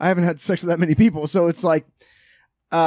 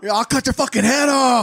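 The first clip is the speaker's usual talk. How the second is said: high-pitched yelled threat; mock threat; 9 semitones higher than usual. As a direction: yell it like a hammed-up menace. Acting out the Australian's threat.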